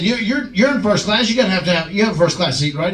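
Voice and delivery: hint of a bully goading; mock needling. JBL needling Glenn.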